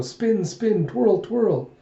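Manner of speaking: light, high chant; playing children. Little kids chanting at their spinning tops, playful.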